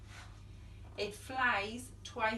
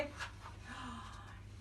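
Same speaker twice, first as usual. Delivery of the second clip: a quiet, hushed gasp of surprise; soft surprised gasp; 4 semitones lower, 14 dB quieter than usual. Acted. Storyteller gasps at the door for the children.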